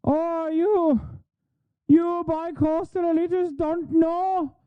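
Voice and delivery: high, slow, soft parody of a folksy Michigander turned leprechaun; exaggerated mocking folksy voice; mock Yooper leprechaun. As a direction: ham it up as a fake Midwest accent sliding into Irish. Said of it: "I'm a leprechaun now".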